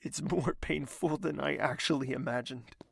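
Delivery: ordinary delivery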